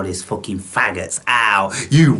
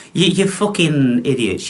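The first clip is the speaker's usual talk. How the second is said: usual speaking voice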